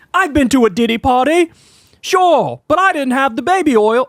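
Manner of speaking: laughing, badly faked British accent; high-pitched horrible mock British accent; Russell Brand. Host retrying Russell on baby oil, bad high British accent. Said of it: "that's a horrible Russell Brand accent".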